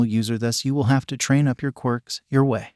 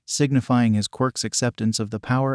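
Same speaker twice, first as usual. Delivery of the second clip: ordinary delivery, nothing marked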